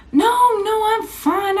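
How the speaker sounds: no no, high and quick; high protest. Retold or imitated. To the coach, high-pitched refusal of the clinic.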